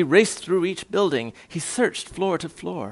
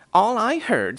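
higher, sharp scolding retort; snappish big kangaroo; 8 semitones higher, 4 dB louder than usual. A narrator's reading. Big kangaroo denies hearing Whos, snappish retort. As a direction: snap it back, pitch up. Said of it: "snapped the big kangaroo".

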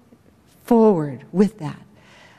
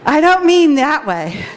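higher, louder, laughing through the words; 8 semitones higher, 5 dB louder than usual. Real, not acted. Correcting her joke about breathing, laughing. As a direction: laugh it off, amused at yourself.

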